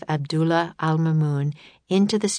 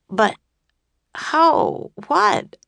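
halting, high, surprised bursts; stammering; 12 semitones higher, 4 dB louder, much slower than usual. A narrator's reading. Jack learns his friend is the caliph, stammering in high surprise. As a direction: stumble over the words, caught off guard. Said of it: "stammered Jack".

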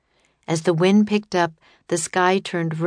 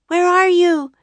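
high, loud searching call; Annie calling out; 13 semitones higher, 7 dB louder than usual. A narrator's reading. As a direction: call through thick dust to find him. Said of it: "she called through the dust".

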